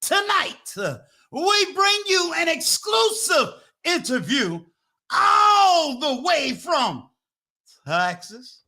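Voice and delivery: big high-pitched MC build-up, stretching key words; hype announcer introduction. Acted. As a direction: bring the guest on stage like a showman.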